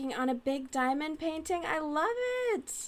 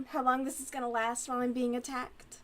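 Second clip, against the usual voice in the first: normal talking voice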